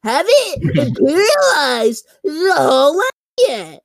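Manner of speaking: high, slow raspy ghoul; horror host. Acted. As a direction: croak it like a Fallout ghoul. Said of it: "Do your best ghoul voice".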